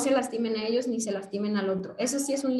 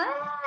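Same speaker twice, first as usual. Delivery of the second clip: mock shout; 11 semitones higher than usual. Demonstrating a yell to a parent, mock shout. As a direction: imitate someone yelling, half-hearted. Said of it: "Si el otro está gritando".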